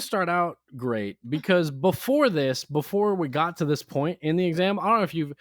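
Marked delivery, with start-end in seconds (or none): none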